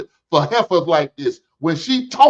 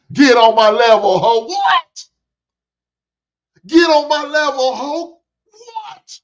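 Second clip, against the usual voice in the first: high mocking taunt with a squeaked "what"; high-pitched taunt; 10 semitones higher than usual. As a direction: taunt them, pitch jumping way up. Hype mock chant, squeaked what.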